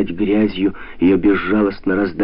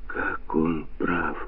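softer, slow, hushed exclamation; inner breathless awe; 2 semitones lower, 9 dB quieter, much slower than usual. A narrator's reading. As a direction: hush it as if frozen inside. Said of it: "обмирала внутренне Джой".

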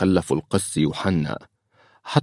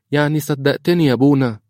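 put-on high, louder child's voice; little girl; 8 semitones higher, 4 dB louder than usual. Daughter asks the priest if he believed her, child voice. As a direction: ask it in a high little girl's pitch. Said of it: "قالتها ملك ليوحنا".

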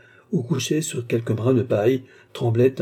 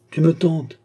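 "tentes" cried out higher and louder; exclaiming; 6 semitones higher, 4 dB louder than usual. A narrator's reading. Old master crying out to tempted pupil. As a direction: cry out "tu me tentes". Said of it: "s'écria Altotas".

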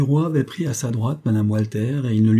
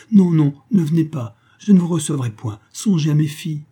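high, louder, urgent refusal; refus affolé; 6 semitones higher, 4 dB louder than usual. A narrator's reading. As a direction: plead in alarm, pitched up and forceful. Frightened refusal of an insistent lover. (supplication affolée)

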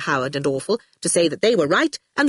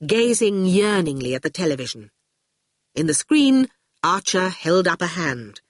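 usual speaking voice

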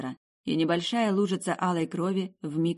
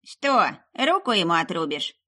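loud scornful crone question; mocking witch; 3 semitones higher, 5 dB louder than usual. A narrator's reading. Witch mocking Meryl's offer, loud and scornful. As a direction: sneer it out.

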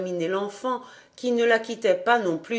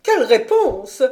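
louder exasperated exclamation; exclaiming; 3 semitones higher, 7 dB louder than usual. A narrator's reading. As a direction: throw up your hands at the answer. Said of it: "s'écria la dame".

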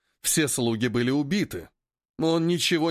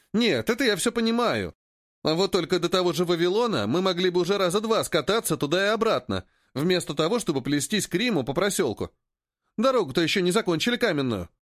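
higher-pitched, argumentative trader; young companion's high grumble; grumbling Поганка; 4 semitones higher than usual. Acted. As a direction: keep protesting in a raised character voice.

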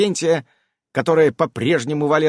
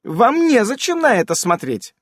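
lecturing; 10 semitones higher than usual. A narrator's reading. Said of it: "назидательно добавила".

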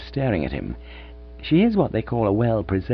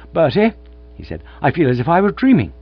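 giddy excitement; 7 semitones higher, 7 dB louder than usual. Acted. Gussie elated and giddy.

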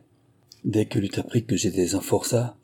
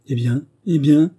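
raised, higher call after him; 6 semitones higher, 6 dB louder than usual. A narrator's reading. Célestine calls after the fleeing convict, loud call. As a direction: stop him on the stairs with your voice. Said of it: "s'écrie cette fille".

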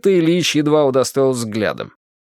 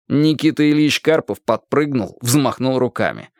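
ordinary delivery

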